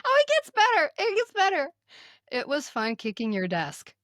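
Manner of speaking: ordinary talk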